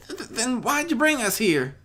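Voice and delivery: high stammering filly voice, frightened; worried demand. Acted. Apple Bloom confronting Discord, high and stammering. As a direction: stutter it out, scared but defiant. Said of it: "Apple Bloom demanded worryingly".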